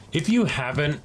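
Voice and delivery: normal talking voice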